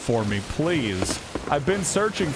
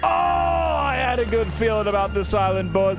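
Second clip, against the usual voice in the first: excited; 8 semitones higher than usual. Real, not acted. Excited about boss.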